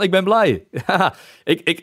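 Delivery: ordinary talk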